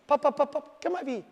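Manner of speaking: high, upbeat call to the dogs; happy calling tone. Shown as a demonstration. Trainer shows owners how to call dogs, high and upbeat. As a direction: lift your voice to call them in. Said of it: "That's the tone I want".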